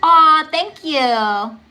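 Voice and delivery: slow, drawn-out aww thank you; touched. Real, not acted. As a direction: melt at a kind comment. Viewer compliment.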